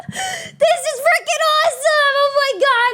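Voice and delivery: overjoyed to the point of tears; happy crying. Real, not acted. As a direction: voice cracking with joy. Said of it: "I'm gonna cry already".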